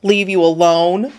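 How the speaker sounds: mocking echo as a question; snorted mockery. Bully echoing the cornered kid's plea, snorting scornfully. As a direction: throw it back with a scornful rise. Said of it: "the kid snorted".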